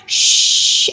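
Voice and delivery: "Shh" as a long hushing hiss; sh sound. Shown as a demonstration. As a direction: hush like quieting a room, no voice. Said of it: "they make a brand new sound".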